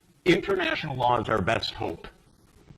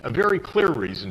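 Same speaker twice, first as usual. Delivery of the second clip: ordinary delivery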